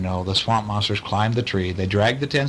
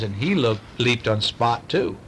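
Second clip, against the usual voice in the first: usual speaking voice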